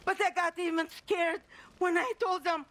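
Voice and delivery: ordinary talk, nothing marked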